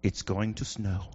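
deadpan weatherman; low, quiet mock forecast. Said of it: "All the weatherman has to say is".

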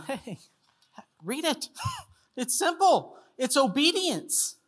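high, lilting mock-patient reply; pointing out the obvious. God answers the complaining believer that it is simple, pointing out the obvious. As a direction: draw it out high as if it were obvious.

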